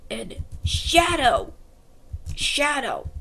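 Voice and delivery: ordinary delivery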